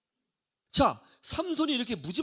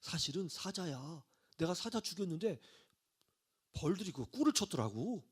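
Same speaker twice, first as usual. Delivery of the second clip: acted reluctant reveal, much softer; quiet confiding; 2 semitones lower, 8 dB quieter than usual. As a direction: let the secret out in a hushed voice. Reluctantly confiding the secret to wife.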